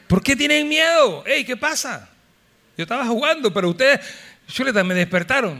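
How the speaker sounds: high, irritated scolding; annoyed Lord. Acted. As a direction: grumble at being woken up. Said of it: "El Señor no estaba muy contento".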